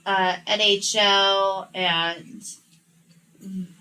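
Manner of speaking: ordinary delivery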